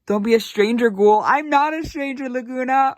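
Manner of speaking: high excited fangirl voice; emotional squeal. Real, not acted. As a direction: squeal back at the doll. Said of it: "gonna start crying".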